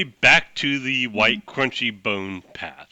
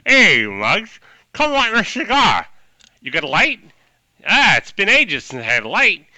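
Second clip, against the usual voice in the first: louder, brash gangster voice; tough guy with cigar; 9 semitones higher, 7 dB louder than usual. Acted. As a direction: demand a light like an old mobster. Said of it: "He's got a big cigar".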